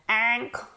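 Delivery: Ankle with a heavily nasal, stretched a; exaggerated nasal ankle. Shown as a demonstration. Teacher exaggerates the vowel for her students. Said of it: "Lo voy a exagerar".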